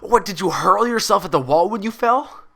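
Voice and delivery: can't believe what he's hearing; exasperated outburst. A narrator's reading. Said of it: "he groaned".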